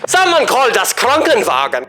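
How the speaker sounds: high, loud cry for an ambulance; mock screaming. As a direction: yell it out like an overacted casualty. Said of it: "Can you imagine someone screaming that".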